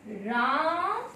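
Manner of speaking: राम split by a long held आ; prolonged vowel. Shown as a demonstration. Teacher showing the extra-long vowel between रा and मा, drawn-out call. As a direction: hold रा long, then close on म. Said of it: "रा और मा के मध्य में".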